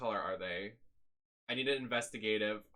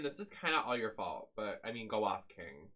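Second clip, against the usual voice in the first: normal talking voice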